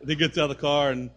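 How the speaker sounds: ordinary talk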